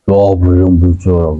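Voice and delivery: mock interrogation. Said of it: "Bukan kayak Fatih kan".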